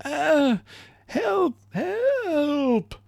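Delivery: high, drawn-out cry for help; long, high wail without extra volume; Erk crying out. Acted. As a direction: call out thinly in a very high put-on voice. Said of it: "you can hear him kind of crying out".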